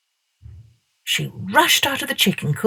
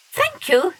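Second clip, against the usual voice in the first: put-on much higher child's voice; polite girl; 13 semitones higher than usual. A narrator's reading. Masha thanking the dog, high. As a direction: thank him sweetly as a little girl.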